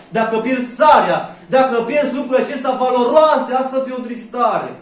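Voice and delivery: loud, insistent sermon voice; forceful preaching; fervent preaching. Acted. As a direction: drive the point home to the church.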